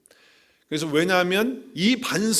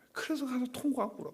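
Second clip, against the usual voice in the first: hushed whisper; 8 semitones higher, 8 dB quieter, faster than usual. Retold or imitated. Peter weeping bitterly, told in a whisper. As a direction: whisper it like a secret grief.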